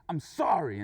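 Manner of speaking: loud, gruffly offered sorry; tough-guy apology. Retold or imitated. Drivers mean-mug each other as they part, tough-guy apology. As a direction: say sorry loudly while keeping face. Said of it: "we mean-mugged each other".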